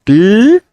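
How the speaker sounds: "cl" with a clear L and the tongue forward; a bright, clear "cl" sound. Shown as a demonstration. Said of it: "la L no está sonando como". ("cl" con L clara, lengua hacia afuera)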